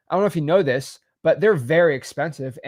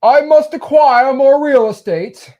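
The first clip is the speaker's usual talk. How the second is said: much higher, louder, slow pompous rich-man voice; snobby rich guy; 11 semitones higher, 10 dB louder, slower than usual. Retold or imitated. Teacher voicing a snobby rich buyer, pompous and high. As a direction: ham up a haughty tycoon craving property. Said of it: "this rich guy that is just like".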